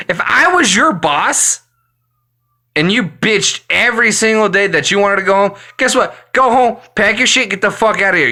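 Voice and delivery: ordinary delivery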